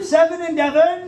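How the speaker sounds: cheeky shouted heckle from the crowd; audience heckle. Retold or imitated. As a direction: yell it up from the back of the room. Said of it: "Someone at the back shouts up".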